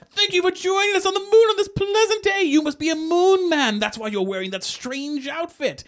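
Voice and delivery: high, loud theatrical host; Cyrano de Bergerac. Retold or imitated. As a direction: declaim it grandly, way up in pitch. Acting Cyrano greeting the astronaut.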